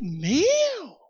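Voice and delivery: drawn-out high "whoa, man"; swooping, astonished cry; amazed exclamation. Retold or imitated. Amazed reaction to job offer retold.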